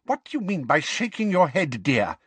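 high, irritated sweetness cracking; barely hiding annoyance behind sugar; testy Umbridge. Acted. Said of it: "in a testy voice".

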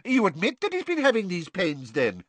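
high, pouncing minister voice; quick Fudge. A narrator's reading. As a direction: seize on it quickly. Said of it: "said Fudge quickly".

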